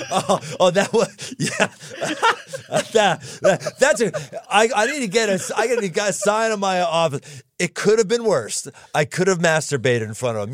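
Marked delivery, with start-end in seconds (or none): 0.1-1.7 laughing. Real, not acted. Laughing at a crude joke. 1.7-2.9 high burst of laughter. Real, not acted. Laughing at a crude joke. 7.6-10.5 amused. Real, not acted. Quoting the joke while laughing.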